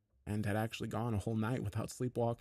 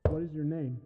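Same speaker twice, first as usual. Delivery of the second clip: higher-pitched, controlled question; restrained, higher-voiced demand; entity's questioning call; 6 semitones higher, 3 dB louder than usual. A narrator's reading. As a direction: call it through the door, still in check. Entity at the door asks.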